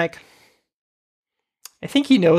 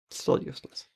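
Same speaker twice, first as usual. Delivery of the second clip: dry, hushed mutter; deadpan mutter; 4 semitones lower, 11 dB quieter than usual. Real, not acted. Podcast host sneering at useless Rothman, dry hushed mutter. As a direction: breathe it out as a dry jab. Mocking aside about Rothman.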